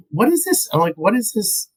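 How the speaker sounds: very high-pitched disbelief; incredulous, voice shooting way up. Real, not acted. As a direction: squeak out the question in mock bafflement. Mocking bad dubbed dialogue.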